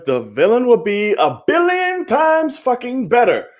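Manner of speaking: high, rising, emphatic rant; emphatic outburst. Real, not acted. Host venting to co-host about the villain, emphatic outburst.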